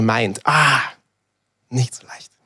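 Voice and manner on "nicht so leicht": a soft whispered admission. Real, not acted. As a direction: breathe it to yourself. Quiet self-admission aside.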